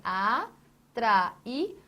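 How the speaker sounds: "atrair" in slow separated pieces, a, tra, i; syllable by syllable. Shown as a demonstration. Teacher splits the word to reveal the hiatus, syllable by syllable. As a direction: pause between each syllable of "atrair". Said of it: "você tem".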